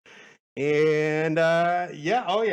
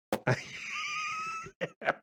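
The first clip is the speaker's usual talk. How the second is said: shrieking laugh; 7 dB quieter than usual. Real, not acted. Burst of laughter after playing.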